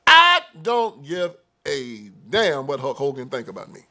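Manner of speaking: ordinary talk, nothing marked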